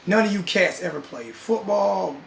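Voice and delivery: ordinary talk, nothing marked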